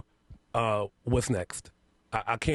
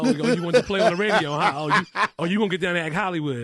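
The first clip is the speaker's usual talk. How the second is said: mock taunting; 5 semitones higher, 5 dB louder than usual. Teasing the host playfully.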